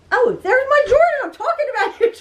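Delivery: high, delighted greeting; light up as he walks in; happy surprise. Real, not acted. Mother spotting her son walking in, high delighted surprise.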